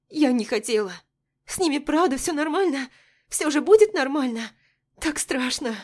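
high, pleading frightened voice. A narrator's reading. Frightened pleading, repeating guilt.

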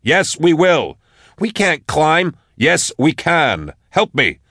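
urgent insistence. A narrator's reading. Argument in panic.

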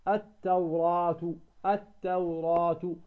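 التوراة with the alif held for two beats, slowly; slow madd demo. Shown as a demonstration. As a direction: stretch the ا in التوراة two counts. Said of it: "لما لفظنا كلمة التوراة".

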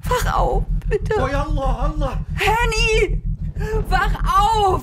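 high, loud sobbing plea; anguished crying shout. Acted. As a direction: beg her to wake up through tears. Rita begs dead friend to wake.

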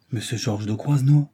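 usual speaking voice

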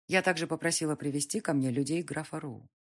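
ordinary delivery

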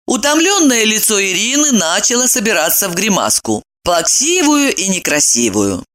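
ordinary delivery

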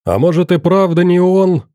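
high-pitched shout from a crowd; calling out. A narrator's reading. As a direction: call out a doubt to everyone. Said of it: "выкрикнул кто-то".

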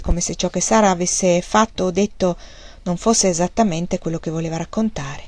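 ordinary delivery

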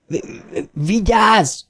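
high stammering warning cry; stuttered yell. A narrator's reading. Bill warns Stan and the others, stuttered high yell. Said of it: "üvöltött Bill".

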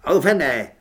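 loud shout of a name; shouted call. A narrator's reading. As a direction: call out sharply down a corridor. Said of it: "Někdo na mě z chodby zakřičel". (hlasité zavolání)

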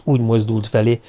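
ordinary delivery

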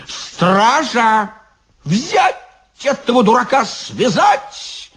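high-pitched witch commands; calling the guards. Acted. Baba Yaga summons guards to seize Ivan, commanding. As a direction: order it in short high bursts. Баба Яга приказывает страже.

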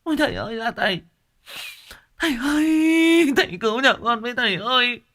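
high, sobbing plea to the shaman; distraught woman wailing to the shaman; wailing cry. Acted. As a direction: wail and beg to be saved. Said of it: "khóc lóc um sùm".

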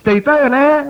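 high, taunting inner voice; mocking little voice. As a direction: tease like a sneering inner critic. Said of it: "elle dit".

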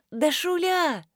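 high, sing-out chirp. A narrator's reading. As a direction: chirp a friend's pet name. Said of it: "защебетала Лариса". (щебечущим голоском)

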